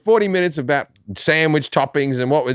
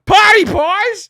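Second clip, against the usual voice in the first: high, loud, drawn-out mock yell; 6 dB louder, much slower than usual. Son yelling at his half-deaf dad beside a viewing, loud. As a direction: holler it at someone hard of hearing. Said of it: "That's why I'm yelling at him".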